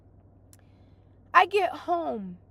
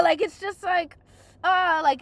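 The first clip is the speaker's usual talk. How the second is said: half-crying shout of frustration; strained venting cry; mock frustrated yell; 4 semitones higher, 5 dB louder than usual. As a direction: cry out in exasperation. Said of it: "I go like this".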